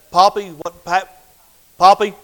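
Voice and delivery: ordinary talk, nothing marked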